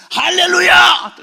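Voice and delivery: a second desperate bold proclamation; drawn-out hallelujah shout. Retold or imitated. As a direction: stretch the shout out over the crowd. Preacher retrying the only word.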